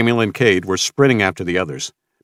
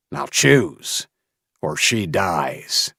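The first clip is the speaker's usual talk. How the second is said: cold menacing order; much slower than usual. Acted. Villain ordering the choice.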